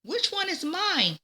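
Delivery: put-on high, eager little-girl question; child Peggy's voice. A narrator's reading. Peggy asking Mr. Cooper which duckling is hers, high and eager. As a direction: voice Peggy as a small excited girl. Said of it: "Peggy asked".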